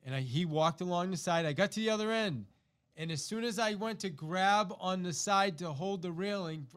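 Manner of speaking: ordinary delivery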